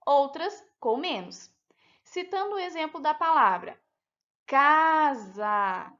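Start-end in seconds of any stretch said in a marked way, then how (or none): 4.5-5.9 first syllable of "casa" drawn out loud, second weaker; stress on first syllable. Shown as a demonstration. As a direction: lean hard on the "ca" in "casa". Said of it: "a sílaba K foi pronunciada mais fortemente".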